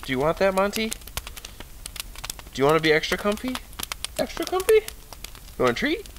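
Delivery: high, soft, sing-song coaxing of a pet. Real, not acted. Owner softly coaxing his dog Monty with a treat, high cooing baby talk.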